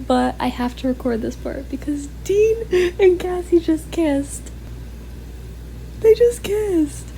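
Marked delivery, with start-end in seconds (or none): 2.2-7.1 high, slow, thrilled repeat; excited squeal. Real, not acted. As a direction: gush about the kiss. Squealing over fictional couple.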